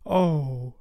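put-on girlish sigh of longing; awed girl. A narrator's reading. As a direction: breathe it out high, tempted. Wendy tempted by mermaids.

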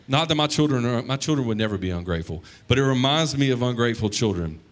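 ordinary talk, nothing marked

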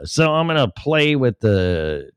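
ordinary talk, nothing marked

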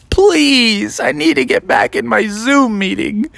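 high, whiny begging; pleading. Acted. Froggy begging mom for her phone, high pleading.

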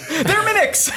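very high, hammed-up squeal; mock reveal. Player reveals what is under the seats, squealed mock reveal. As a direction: burst out with a squealed punchline.

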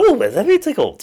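very high, loud comedian impression. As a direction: squeal it like a tickled music-hall comic.

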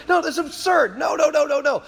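rapid-fire insistent no; emphatic rejection. Real, not acted. Rejecting the argument he just voiced.

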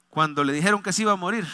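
ordinary talk

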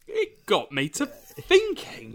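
overacted school play line; hammy stage drama. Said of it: "Are you doing your G C S E drama?".

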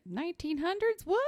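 high, incredulous echo; mock disbelief. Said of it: "They're like".

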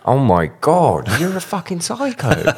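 high, loud shocked exclamation. Real, not acted. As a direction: react with playful disbelief. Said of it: "mock shock at song choice".

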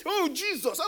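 high, hushed gabble of scandalised gossips; mock gossips' shock. Gossips reacting to someone's shame, high mock shock.

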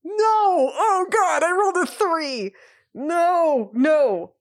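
loud, high wail of dismay; dismayed outcry. Real, not acted. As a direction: cry out at a disastrous dice roll. Bad dice roll, real dismay.

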